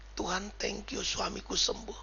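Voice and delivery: soft, simple thanksgiving; modelled quiet prayer. Shown as a demonstration. Preacher showing a wife how to thank God, soft prayer. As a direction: demonstrate a hushed prayer of faith.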